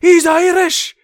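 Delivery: very high, loud yell; mock excited shout. Streamer imitating a YouTuber finding an Irish character, excited yell. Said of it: "He's like".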